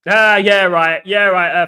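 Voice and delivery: loud dismissive retort; scoffing. Real, not acted. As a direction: scoff loudly at the doubter. Scoffs at viewer's passport claim.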